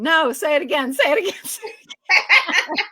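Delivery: high, insistent mock demand breaking into laughter; insistent director. Actress imitates director demanding repeated takes, insistent and laughing.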